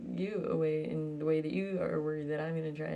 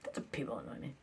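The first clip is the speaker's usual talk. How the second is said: ordinary talk, nothing marked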